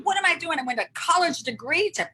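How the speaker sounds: high, loud indignant grumble; feisty complaint. Retold or imitated. College graduate hauling rocks at the ashram, high loud mock outrage. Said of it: "so feisty about the whole thing".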